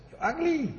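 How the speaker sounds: high, soft singsong bird voice; insulting parrot. Acted. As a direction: deliver the insult as a cheeky parrot. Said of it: "And the parrot says".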